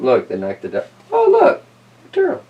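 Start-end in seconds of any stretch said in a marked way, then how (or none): 1.1-2.4 much higher squeaky voice; silly high voice. Acted. A crew member spots a turtle, squeaky high voice. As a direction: pipe up like a tiny character.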